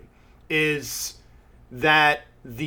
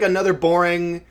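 usual speaking voice

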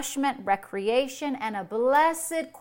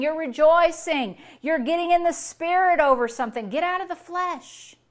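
normal talking voice

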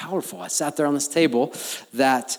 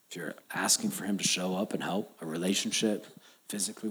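hushed appeal; 5 semitones lower, 8 dB quieter, faster than usual. Real, not acted. Intimate sermon appeal.

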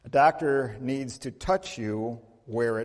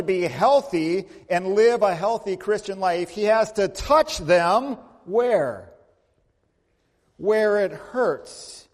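slow, higher, louder emphatic preaching; 6 semitones higher, 6 dB louder, slower than usual. Acted. A pastor tells his congregation where Jesus touches, slow emphatic preaching. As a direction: drive the point home slowly. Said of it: "preacher building emphasis".

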